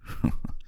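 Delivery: short breathy chuckle; teasing chuckle. Acted. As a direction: chuckle lightly after teasing. Teases host about cooking for him.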